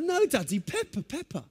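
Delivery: put-on high whiny child insisting; little son refusing a hug, high whining; child whine. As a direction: whine like a small boy glued to cartoons. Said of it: "He's like".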